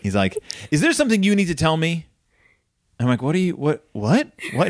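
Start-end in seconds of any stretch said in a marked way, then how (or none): 0.7-2.0 high-pitched, urgent parental interrogation; hammed-up dad confrontation, pitched high; accusing dad. Dad confronts son in retold story. 3.2-4.7 mildly hammed-up confusion, pitched higher; retold baffled stammer; baffled teen. Retold baffled reply to dad.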